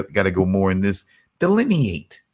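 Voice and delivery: ordinary delivery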